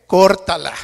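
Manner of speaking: loud mock-angry cutoff; ant snapping. Ant breaks off the friendship with the elephant, loud and cutting. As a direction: bark it out to end the friendship.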